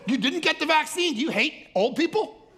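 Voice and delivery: high, loud, laughing mock accusation; mock outrage. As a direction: ham up the scolding critic. Mocking other side.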